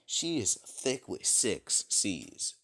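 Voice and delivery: slow, spaced-out emphasis on each word; emphatic admiration. Acted. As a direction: punch it out word by word. Spelling out how thick she is.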